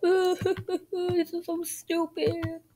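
high, drawn-out mock sobbing; tearful high-pitched whine; pretend crying. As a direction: wail it slowly through whimpers. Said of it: "why are you crying".

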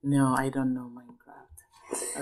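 whispered admission, slow; a playful whispered no. Real, not acted. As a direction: breathe it low and slow, like a confession. Mother admits to child.

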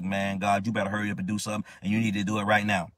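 fast, impatient rush at God; a hint of a harsh demand; retold impatient demand. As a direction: hurry God along, pushing hard. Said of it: "in a harsh way".

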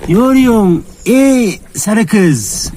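high, drawn-out sing-song call to an animal; calling the cow. Real, not acted. Farmer calling the yellow cow, high and drawn out. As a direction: coax her over with a high stretched call.

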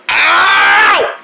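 high, loud yelp of pain; pained shout. Acted. Boy hurting his finger in the door, loud yelp. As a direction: yelp like the finger just got crushed.

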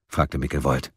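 plain reading voice

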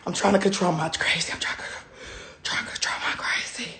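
whispered, near crying; strained whisper. Real, not acted. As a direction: hold it in through a whisper. Holding back anger.

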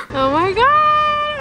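excited gasp. Real, not acted. Arriving at Moulin Rouge.

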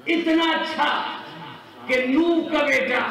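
high, forceful sermon crescendo; full-force preaching. Real, not acted. Preacher building to the Prophet's question, impassioned high preaching.

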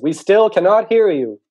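high, slow, loud holler; drawn-out call across a distance; shouting back. Acted. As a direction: stretch it out so it carries. Mole calls back across the distance.

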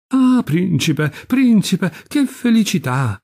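high, drawn-out gushing welcome; delighted greeting. A narrator's reading. Marchesa rushing to greet the prince, gushing with delight. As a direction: gush over the prince, pitch climbing.

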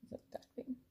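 soft, unvoiced jealous whisper; a sulking bird whispering to itself; whispered grumble. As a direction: hiss the name under your breath. Pigeon mutters name under breath.

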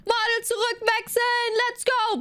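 high, bright hype shout; hyped announcement. Acted. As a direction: announce it like a showman. (aufgedrehte Ansage)

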